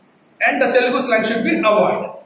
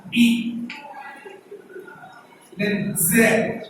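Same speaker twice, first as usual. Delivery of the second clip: broken into syllables; 4 semitones lower, 4 dB quieter, much slower than usual. Shown as a demonstration. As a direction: sound by sound, long pauses between. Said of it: "you need to break".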